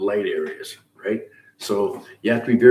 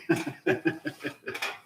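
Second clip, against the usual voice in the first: quiet, higher-pitched chuckle; soft laugh; 6 semitones higher, 8 dB quieter than usual. Real, not acted. Host teasing Matt about turning up, soft laugh. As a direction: chuckle softly to yourself.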